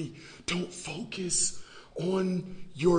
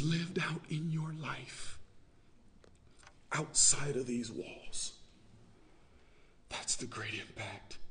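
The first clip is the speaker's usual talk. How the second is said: hushed whisper; 2 semitones lower, 8 dB quieter than usual. Real, not acted. Preacher whispering the point to the church, hushed. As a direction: drop to a whisper for the key line. Sermon point dropped to a hush.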